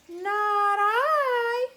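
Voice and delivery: high, loud meowing whine; whiny cat. A narrator's reading. Cat refusing to help the hen, high whiny voice. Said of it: "said the cat".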